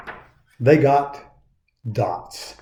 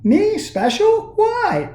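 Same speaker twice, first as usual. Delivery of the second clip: very high, disbelieving questions; he can't believe he's special; squeaky doubtful puppet; incredulous Punchinella; 12 semitones higher than usual. A narrator's reading.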